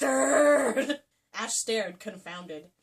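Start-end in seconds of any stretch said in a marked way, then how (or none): 0.0-1.0 hammed-up curse.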